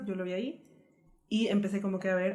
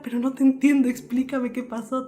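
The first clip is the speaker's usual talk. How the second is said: upset friend's voice; 5 semitones higher, 9 dB louder than usual. Retold or imitated. Friend crying on the phone asks what happened, louder tearful plea.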